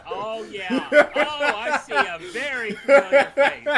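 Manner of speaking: high, loud words through laughter; laughing hard. Real, not acted. Player recognizes a familiar face in the game, laughing loudly. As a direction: burst out laughing while speaking.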